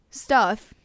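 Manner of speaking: loud emphatic title call; loud title announcement. Acted. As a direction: boom out the title, loud and falling.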